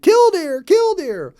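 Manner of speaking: high, loud piping bird cry; piped bird call. Acted. Plover piping an alarm over the possum, high and loud. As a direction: pipe it out as loud as a little plover can. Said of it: "he piped as loudly as he could".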